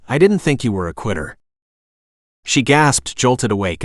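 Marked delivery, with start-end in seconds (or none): none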